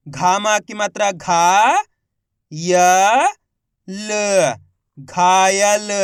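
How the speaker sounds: long घा, य, ल, then घायल stretched; slow spelling into a drawn-out घायल; letter by letter. Shown as a demonstration. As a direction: sound out each letter, then blend. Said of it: "एक अक्षर का उच्चारण करके".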